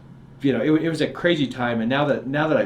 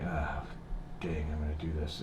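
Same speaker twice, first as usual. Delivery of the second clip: muttered to himself; 8 semitones lower, 11 dB quieter than usual. Retold or imitated. Retelling his inner resolve.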